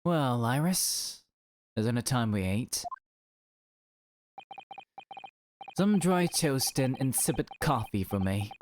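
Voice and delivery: lightly voiced glum detective, drawn out; a touch of slow, weary character voice; listless Sholmes. Detective asks Iris for breakfast, lightly acted listless voice.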